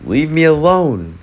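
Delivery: high-pitched, contained storybook shout; narrated outburst, voice jumping up; angry yell. A narrator's reading. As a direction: yell it like a fed-up boy in a story. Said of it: "he yelled at everybody".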